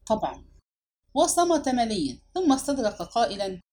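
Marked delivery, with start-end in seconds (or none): 0.1-0.4 brief, low, clipped-off reply; curt. A narrator's reading. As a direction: answer tersely in one low word. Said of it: "ثم قال باقتضاب".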